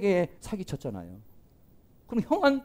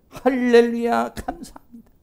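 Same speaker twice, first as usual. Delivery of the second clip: mock grateful praise; 5 semitones higher, 5 dB louder than usual. Believer thanking God for a blessing, hammed-up praise. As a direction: sing out thanks like a pleased believer.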